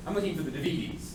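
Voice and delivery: "D V Ds" said oddly as de-VEE-dees; wrong-syllable stress. Shown as a demonstration. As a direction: lean on the V in "D V Ds". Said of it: "I changed the emphasis on the different syllable".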